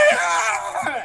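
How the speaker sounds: shrill terrified scream; high scream. Acted. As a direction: let out a high panicked shriek. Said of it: "And he starts screaming".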